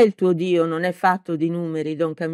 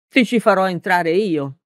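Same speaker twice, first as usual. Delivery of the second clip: ordinary delivery, nothing marked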